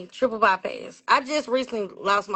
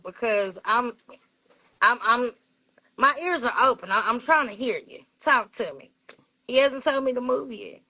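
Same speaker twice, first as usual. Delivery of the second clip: ordinary talk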